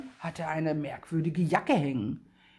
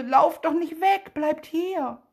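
much higher, friendly, louder call; 10 semitones higher, 4 dB louder, slower than usual. A narrator's reading. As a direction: call kindly in a bright high voice. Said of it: "Die Stimme klingt ganz hoch und freundlich".

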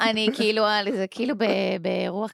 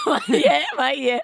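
laughing. Real, not acted. Cracks up at a random word.

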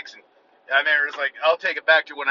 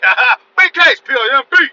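loud, higher gloating cheer; 11 semitones higher, 12 dB louder than usual. Retold or imitated. Boasting about his new kicks, loud and higher. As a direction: crow it out in triumph. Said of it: "But I'm like".

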